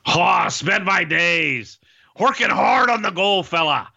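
half-sing it high, stretching the words theatrically; Roddy Piper. Acted. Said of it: "what might it sound like if Roddy Piper sang".

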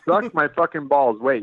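normal talking voice

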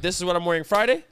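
rattling off quickly; quick checklist voice; fast listing. Retold or imitated. Quoting own packing, fast.

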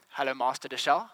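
low, lightly voiced stern headmaster; headmaster's greeting. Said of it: "And then he says".

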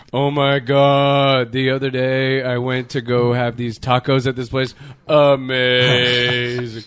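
low, mock-orgasmic raving; imitated ecstatic gushing, low and stretched out; Elliot. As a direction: swoon over it, dragging out the exclamations. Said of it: "he gets, like, orgasmic about shit".

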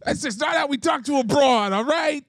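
high put-on character voice; hammed-up protest, pitched well up; Pretzi. Explaining how to talk to a broad, high character voice. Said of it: "the Pretzi".

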